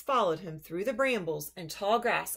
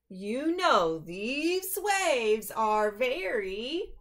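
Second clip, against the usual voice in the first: trailing off; 4 semitones higher, 4 dB louder, much slower than usual. Acted. Duck trails off.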